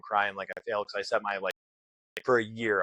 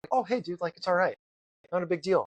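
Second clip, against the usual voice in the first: mock friendly instructor; 8 semitones higher than usual. Instructors suddenly friendly after quitting.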